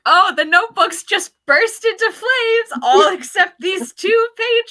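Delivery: high, loud breezy fake innocence; mock Hava. Reader mimics Hava's too-casual excuse, high and loud. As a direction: play Hava shrugging off the fire, high and loud. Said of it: "when Hava's like".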